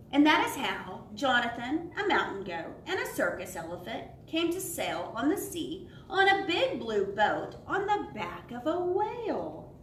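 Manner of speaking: lively storybook read with voices for each animal; animated children's story narration. A narrator's reading. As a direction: read it aloud like a bedtime picture book. Reads shipwreck and whale part.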